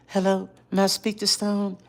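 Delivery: very high, soft phone voice. Retold or imitated. Caller asking for Stone, high soft imitation. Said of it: "this is how he sounds".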